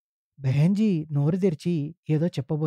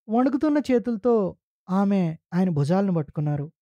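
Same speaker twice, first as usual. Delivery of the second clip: ordinary delivery, nothing marked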